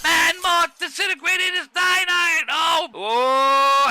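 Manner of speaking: high, loud commentator shout; excited shout. Real, not acted. Commentator shouting at the disintegrated club, excited. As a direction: yell it like the crowd just exploded. Commentator reacting to big hit.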